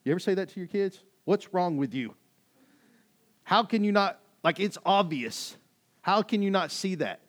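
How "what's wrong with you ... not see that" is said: loud, high-pitched exasperation; frustrated parent. Acted. Parent losing patience with the kids, frustrated. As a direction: raise your voice in disbelief. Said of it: "frustrated with your kids and you talk like that".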